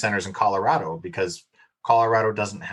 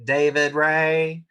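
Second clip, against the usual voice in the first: very slow, stretched rural Oklahoma drawl; 4 semitones higher, slower than usual. Retold or imitated. Mom leaving her kid a voicemail, imitated slow Oklahoma drawl. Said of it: "I don't have that accent unless I get tired".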